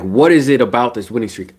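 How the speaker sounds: ordinary talk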